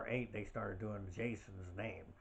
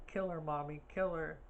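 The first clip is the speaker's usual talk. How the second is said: higher-pitched mimicked movie mother; a raised, urgent quoting voice; Mrs. Voorhees; 7 semitones higher, 3 dB louder than usual. Horror fan quotes the killer mother in close-up, voice raised. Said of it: "and she goes".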